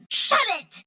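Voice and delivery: high, exclaimed "shut it"; high-pitched telling-off. Acted. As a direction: tell them off with pitch way up. Alternate takes telling someone off.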